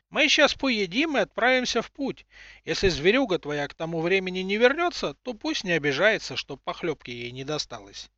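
put-on high cracked old crone voice, calling; old woman voice. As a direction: call out like a grumbling grandma. Old woman talks to boy.

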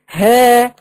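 ها as one long, loud held aa; madd with alif. Shown as a demonstration. Breaking the word down for students, alif lengthened. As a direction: hold the aa of ها. Syllable shown with prolonged alif.

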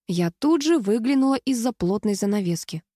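usual speaking voice

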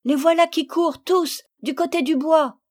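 high loud shout of alarm; excited cry. Acted. Marguerite shouting as hedgehogs run to woods. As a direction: point and shout after them. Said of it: "s'écria Marguerite".

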